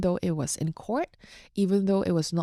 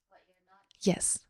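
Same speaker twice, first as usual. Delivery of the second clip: whisper; 6 dB quieter than usual. Real, not acted. Searching for the print's words.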